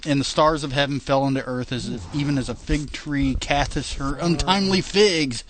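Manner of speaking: reading scripture aloud, steady and measured; King James reading. Acted. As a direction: read it like a Bible passage. Reads scripture.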